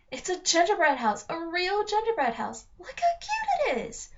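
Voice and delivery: high, squealing delight; thrilled girl voice; excited. A narrator's reading. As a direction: gush over how cute it is. Alex thrilled at gingerbread house.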